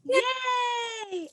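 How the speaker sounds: a high, loud, delighted yay; excited cheer. Real, not acted. Cheering after the song.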